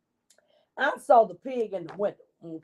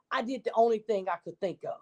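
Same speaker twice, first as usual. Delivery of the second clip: usual speaking voice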